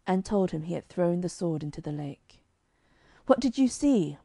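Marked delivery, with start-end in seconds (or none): 3.3-4.2 higher, lifted with eager hope; hungry for good news, pitch it up; a dying king eager to hear the knight's report; eagerly. Acted. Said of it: "asked the king eagerly".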